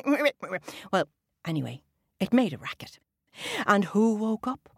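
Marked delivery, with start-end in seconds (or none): 0.1-0.6 frog ribbit. Shown as a demonstration. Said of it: "laughing and ribbiting at the same time".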